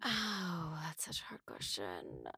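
hushed, breathy whisper. Real, not acted. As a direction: whisper it like a secret. Pondering a hard question aloud.

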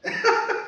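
tiny airy laugh, high and soft; breathy giggle. Real, not acted. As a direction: let a little laugh escape. Soft laugh after the quote.

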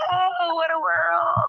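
high, slow wailing screech; witch voice. Pretend. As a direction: wail it like a dying witch. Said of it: "And then you hear".

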